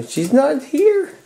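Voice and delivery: high, slow sing-song dog talk with rising end; high pet talk. Real, not acted. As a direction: coo it up high to the pup. Cooing to lonely dog.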